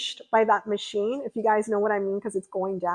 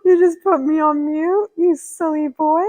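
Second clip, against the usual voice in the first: playful teasing; 7 semitones higher, 9 dB louder than usual. Real, not acted. Teasing a viewer on stream.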